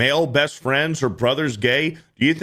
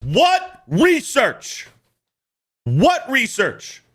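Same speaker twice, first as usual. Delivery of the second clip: high, slow disbelieving repeat; what research, drawn out and high; incredulous; 9 semitones higher, much slower than usual. Real, not acted. Mocking the woman's claim, high incredulous question.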